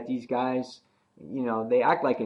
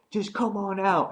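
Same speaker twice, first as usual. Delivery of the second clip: much higher, coaxing threat; luring taunt; 9 semitones higher than usual. Retold or imitated. Nicholas calling Glenn out, much higher coaxing. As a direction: coax them out mockingly. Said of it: "he's like".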